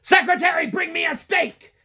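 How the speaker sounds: a pampered boss yells down the phone for his steak; bossy shouted order. Retold or imitated. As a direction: bark it at the secretary like a spoiled boss. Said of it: "He's like".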